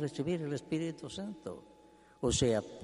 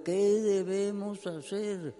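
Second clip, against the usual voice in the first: higher, desperate plea, slow on the question; pleading crowd; 9 semitones higher, 3 dB louder, slower than usual. Acted. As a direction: beg Pedro as a crowd convicted of sin. Weeping crowd before Peter.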